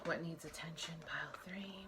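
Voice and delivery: breathy whispered question, barely audible. Shown as a demonstration. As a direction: whisper it like a secret to the cards. Tarot reader whispers question to pile.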